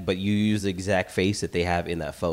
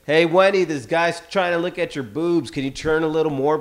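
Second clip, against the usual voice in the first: higher, louder teasing call to his sister; mock call-out; 7 semitones higher, 3 dB louder than usual. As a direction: call out so the guy hears it, a bit raised. Brother calling out to sister.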